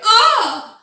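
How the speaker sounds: high, loud mock gasp of shock; imitated shocked cry. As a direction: yelp as if suddenly grabbed. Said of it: "the noise she made is how she imagines he would have reacted".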